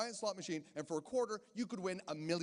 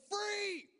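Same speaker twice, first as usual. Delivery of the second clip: much higher, excited yelp; gleeful cry; 13 semitones higher than usual. Retold or imitated. Young man in Vegas refusing to waste free beer. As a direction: squeal it like a prize.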